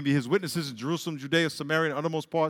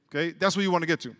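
usual speaking voice

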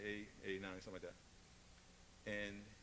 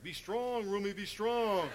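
high, loud, slowed plea; mock pleading from the brother; urging brother; 7 semitones higher, 9 dB louder, slower than usual. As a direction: cheer him on to hold firm. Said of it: "my brother's like".

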